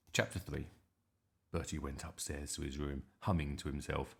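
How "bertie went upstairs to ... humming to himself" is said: plain reading voice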